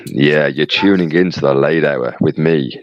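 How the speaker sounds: low, sexy late-night radio voice; midnight DJ. Acted. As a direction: drop low and host the late show. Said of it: "you're sounding like a midnight D J".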